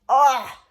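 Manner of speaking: loud short yelp; knocked to the floor; cry of pain. Acted. Boy shoved to the floor.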